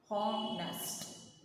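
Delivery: "Honest" drawn out as hon-nest; two measured beats on "Honest"; teacher counting out the syllables of "Honest". Shown as a demonstration. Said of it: "Two times".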